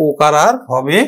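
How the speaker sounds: ordinary talk, nothing marked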